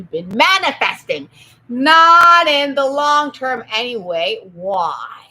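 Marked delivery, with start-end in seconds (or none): none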